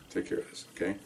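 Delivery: hushed, whispered self-reassurance; self-calming whisper. Retold or imitated. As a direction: whisper it to yourself to stay cool. Calming himself, repeats it softer.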